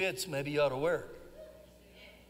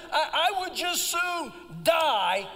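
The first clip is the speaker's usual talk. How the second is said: sulking Jonah; 6 semitones higher, slower than usual. Retold or imitated. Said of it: "But he says here".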